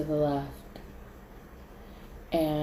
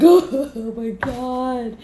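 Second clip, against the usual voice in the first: loud, high, drawn-out cry; overwhelmed outburst; 6 semitones higher, 7 dB louder, much slower than usual. Retold or imitated. Reacting after being given a shot, overwhelmed outburst. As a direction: wail it slowly and loudly.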